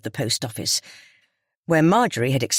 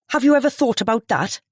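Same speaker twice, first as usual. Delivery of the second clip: snapping; 8 semitones higher than usual. A narrator's reading. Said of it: "Missus Ball snapped".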